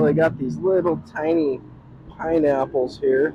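usual speaking voice

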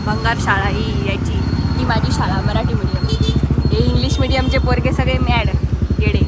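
loud playful taunting; mock boasting. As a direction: tease him loudly about his school. Mocking son's English-medium school. (मुलाला चिडवणारा मोठा खोडकर आवाज)

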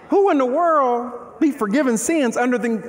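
ordinary talk, nothing marked

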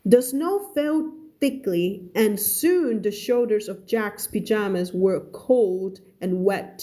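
slow, careful read-aloud of a novel; story reading. Acted. Teacher reading a story to her students, slow and careful.

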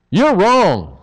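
smug accusation set against a humble confession; mock accusing jab. Shown as a demonstration. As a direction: jab the finger, easy blame. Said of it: "That's easy".

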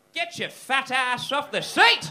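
drawn-out, high, hammed-up furious yell; old guy cracking it. Old guy yelling at pregnant lady, hammed-up rage. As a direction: bellow it slowly as a mock irate old man. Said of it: "the old guy cracked it and just went".

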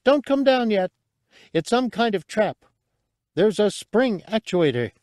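a small creature's scared high cry; frightened squeak. A narrator's reading. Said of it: "a terrified little squeak".

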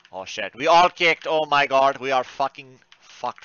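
ordinary talk, nothing marked

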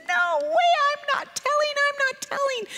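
very high, tiny defiant voice; refusing child. Retold or imitated. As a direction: squeak out a stubborn refusal.

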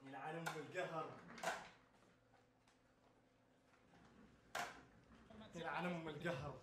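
soft, low, defeated muttering; muttered curse. Real, not acted. Gamer muttering curses to himself after losing, soft and low. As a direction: grumble it under your breath.

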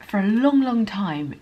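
usual speaking voice

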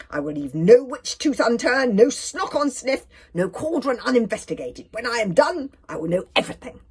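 loud, weighty theatrical vow; grand boast. A narrator's reading. Kamikaze vowing no cauldron goes uninvestigated, grandly boastful. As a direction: hammer out each promise.

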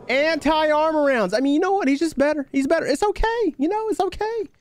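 high-pitched, whiny resignation; squeaky put-upon griping; exasperated complaint. Real, not acted. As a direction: concede defeat in a high, aggrieved voice. Losing to a better-armed player.

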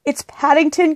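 high cry called over the shoulder; urgent cry. A narrator's reading. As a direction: call back high, in a hurry. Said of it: "cried Judy over her shoulder".

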